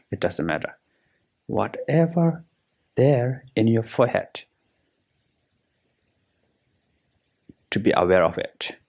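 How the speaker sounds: guided meditation. Acted. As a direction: guide them through relaxing, unhurried and measured.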